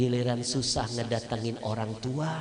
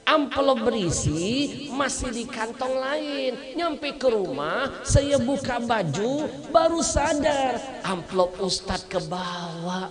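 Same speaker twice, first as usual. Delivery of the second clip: higher, slow, louder anxious account; nervous doctor explaining; 11 semitones higher, 4 dB louder than usual. Acted. Doctor explains the mix-up to the ustadz, high and slow. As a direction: explain yourself earnestly and worried.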